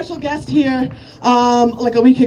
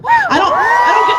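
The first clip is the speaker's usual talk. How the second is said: sudden shout. Real, not acted. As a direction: blurt it out in a high yell. Outburst mid-verse.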